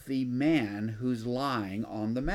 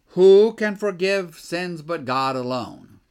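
louder, slow outraged question from the scribes; indignant scribes; 2 semitones higher, 11 dB louder than usual. Retold or imitated. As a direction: voice the scribes' shocked protest, much louder and slower. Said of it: "they're thinking to themselves".

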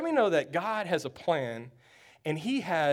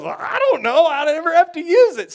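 clueless player voice; 9 semitones higher, 8 dB louder than usual. Retold or imitated. Clueless Steve answers coach.

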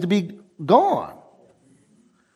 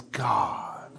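whispered awe; 8 semitones lower, 10 dB quieter than usual. Real, not acted. Awed about God's presence.

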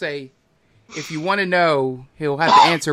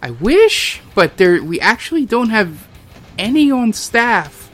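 higher, louder regretful innkeeper; 5 semitones higher, 9 dB louder than usual. Acted. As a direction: apologise loudly to the customers.

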